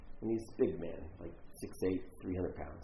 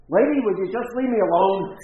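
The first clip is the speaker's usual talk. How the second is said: loud, high acted outburst; retold yelling; 7 semitones higher, 14 dB louder than usual. Homeless man yells at the intern offering lunch, exasperated shout. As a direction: play him yelling to be left alone. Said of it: "this guy's yelling at me".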